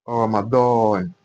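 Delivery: "Ramadan" slow with a heavy d; Arabic Ramadan. Shown as a demonstration. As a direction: stretch out "Ramadan" with a thick d. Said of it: "The correct pronunciation".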